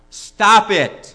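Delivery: loud, high, falling outburst; shouted order. Acted. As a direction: burst out with a blunt command. Therapist delivers his two words.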